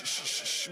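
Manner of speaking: voiceless "sh" hushes in a row; repeated shushing. Real, not acted. Host hushing the crowd before a confession, repeated shushes.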